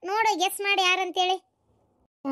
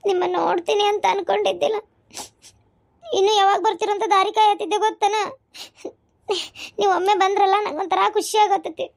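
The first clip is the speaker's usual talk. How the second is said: higher-pitched, weepy relief; tearful joy; 4 semitones higher, 3 dB louder than usual. Acted. Wife telling husband how she waited for him, crying happily. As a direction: talk through happy tears. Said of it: "ಎದಕ್, ಎದಕ್ ಅಳಾಕತ್ತಿ?".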